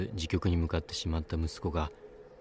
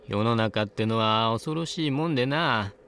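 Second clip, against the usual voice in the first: put-on higher, louder old crone voice; high old woman voice; 6 semitones higher, 6 dB louder than usual. A narrator's reading. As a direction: speak up high like an old hag. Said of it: "ワンオクターブ高い声で解説を始めた".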